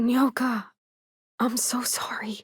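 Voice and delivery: soft slow whispered apology. Acted. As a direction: breathe the apology almost silently. Quiet apology to Nyoka.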